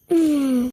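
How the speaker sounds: low, soft ghostly hum; a deep moaning hum to frighten; spooky moan. Acted. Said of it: "ഇപ്പോൾ തന്നെ പേടിപ്പിച്ചേക്കാം".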